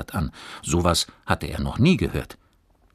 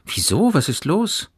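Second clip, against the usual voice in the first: high, loud urgent questions; calling out; 13 semitones higher, 5 dB louder than usual. A narrator's reading. Boy wants to know what is wrong, calling out. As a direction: ask in startled alarm. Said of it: "rief Johannes".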